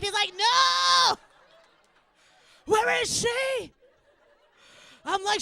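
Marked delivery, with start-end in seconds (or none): 0.4-3.7 very high, loud drawn-out wail; terrified child. Acted. Son scared of tooth fairy, terrified wail. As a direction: shriek it like a panicked kid. Frightened child reacting to threat.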